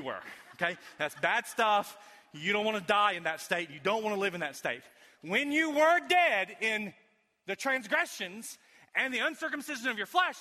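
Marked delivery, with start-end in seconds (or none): 5.2-10.4 forceful preached reading. Shown as a demonstration.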